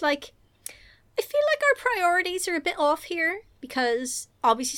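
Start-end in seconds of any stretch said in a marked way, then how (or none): 1.2-4.8 slightly raised, lightly voiced worrier; a hint of a fussy Tom. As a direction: object politely but pointedly.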